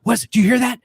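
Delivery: high, urgent mock alarm; shush, then question like a jumpy crowd; acting out a startled audience; imitated anxious moviegoers. Audience hears a strange sound.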